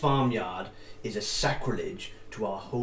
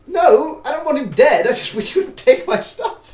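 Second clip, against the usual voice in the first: much higher, louder protest; upset whine; 14 semitones higher, 10 dB louder than usual. Acted. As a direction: protest shrilly through laughter. NPC protests, laughing.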